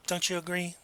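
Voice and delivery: soft polite tag question; condescendingly polite. Shown as a demonstration. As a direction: gently press for agreement. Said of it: "people who are very condescending".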